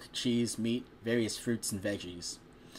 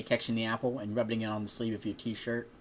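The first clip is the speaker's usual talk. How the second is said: ordinary delivery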